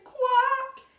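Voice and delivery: high, loud quack; duck quack. Retold or imitated. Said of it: "they're saying".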